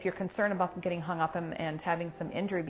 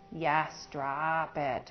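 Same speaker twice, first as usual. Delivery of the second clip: slow drawn-out cue; 3 semitones lower, much slower than usual. Real, not acted. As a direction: drag out "yes, drop it" very slowly.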